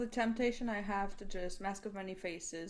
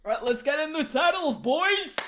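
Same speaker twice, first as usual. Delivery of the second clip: loud, higher call to the troops; rallying call; 6 semitones higher, 7 dB louder than usual. Acted. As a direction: call the riders to mount up. Rallying the party to ride.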